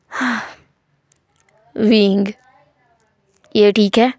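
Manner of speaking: a gruff man forcing tenderness; hushed careful sweetness; softened voice. Acted. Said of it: "ये बहुत अच्छा है, ऐसे ही बात करना".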